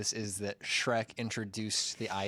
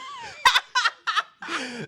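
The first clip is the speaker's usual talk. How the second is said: loud, high burst of laughter; helpless laughter; 7 dB louder than usual. Real, not acted. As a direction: crack up loudly at an absurd idea. Cracks up at class struggle reasoning.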